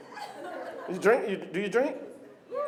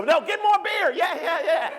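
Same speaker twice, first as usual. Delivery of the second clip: put-on drunk cheer; 7 semitones higher than usual.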